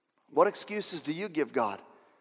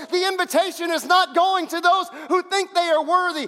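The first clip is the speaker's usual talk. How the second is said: much higher, loud preaching shout; preaching at full force; 8 dB louder than usual. Acted. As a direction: drive it home like a revival preacher. Preaching climax.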